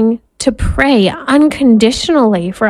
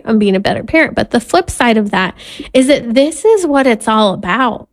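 ordinary talk, nothing marked

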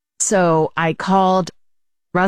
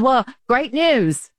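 drawn out and higher, teasing; slow playful reveal of good news; 7 semitones higher, much slower than usual. Acted. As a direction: stretch it out to build suspense.

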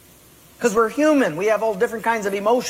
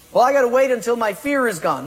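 quick, higher whiny excuse; hurried, higher mock-whiny excuse; 6 semitones higher, 4 dB louder, faster than usual. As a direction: rattle off a nervous excuse.

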